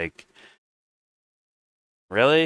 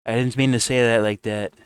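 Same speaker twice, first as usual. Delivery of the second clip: higher, pleading hammed-up Trump; mock apologetic Trump; 4 semitones higher, 5 dB louder than usual. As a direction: play Trump grovelling on the phone. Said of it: "Did he call him up and say".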